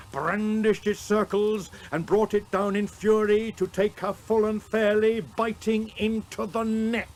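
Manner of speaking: rising intensity. A narrator's reading. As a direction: storm through the climax of the battle. Climax of the fight.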